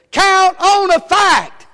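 high, loud and slow, each word hammered; shouted slogan. Shown as a demonstration. Preacher drilling the key phrase to the church, shouting. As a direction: bellow it like a rallying cry. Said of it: "Say it with me".

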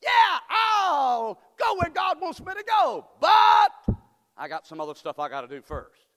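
loud, high, slow put-on excuse; shouted mock excuse. Preacher shouting the excuse this guy made to Jesus. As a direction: bellow the excuse. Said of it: "That's what this guy said".

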